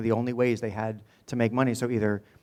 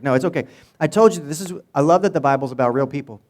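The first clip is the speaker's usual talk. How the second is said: ordinary delivery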